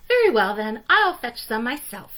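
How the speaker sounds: fine, I'll do it myself; determined hen. A narrator's reading. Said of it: "said the Little Red Hen".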